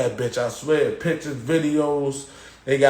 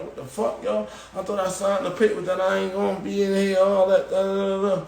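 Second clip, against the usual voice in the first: higher-pitched complaint; retold indignant protest; 6 semitones higher than usual. Inmate to guards about signed papers, higher indignant complaint. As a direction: protest it as if you were cheated.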